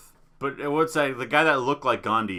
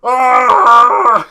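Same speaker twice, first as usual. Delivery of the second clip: hammed-up horror scream, high and loud; 9 semitones higher, 14 dB louder than usual. Icarus's dad locked in darkness, horror scream. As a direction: let out a horror-movie scream. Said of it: "horror screams".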